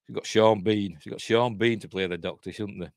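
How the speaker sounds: ordinary delivery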